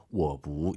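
我 held low before a rising 不; 我 stays low, never lifting; correct low tone. Shown as a demonstration. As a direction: keep 我 down in a low half third tone. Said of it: "이걸 지키셔야 돼요".

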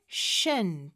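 "shen" with a long hushing SH; slow demo syllable "shen", starting on sh; SH sound. Shown as a demonstration. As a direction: draw out the sh in "shen". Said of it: "에스에이치 발음이 나는 거죠".

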